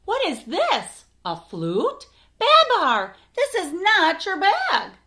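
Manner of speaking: high, loud dismay, slowing on the question; rising alarm at a wrong bag; alarmed surprise. Acted. Celeste telling Babar the bag isn't his, high and alarmed. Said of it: "she cries".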